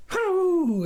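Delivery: high whooping hoot. Acted. Stranger hooting with laughter at the farmer, high whoop. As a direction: let out a wild hoot.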